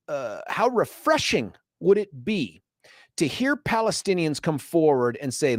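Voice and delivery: usual speaking voice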